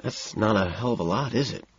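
softly, hushed, questioning; a quiet disappointed murmur; hushed and gentle, almost tender; gentle whisper. A narrator's reading. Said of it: "Price whispers in a surprisingly gentle way".

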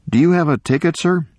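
politely put, lifted high at the end; polite question. A narrator's reading. Attorney asking the old man for his ticket, politely. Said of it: "He said politely".

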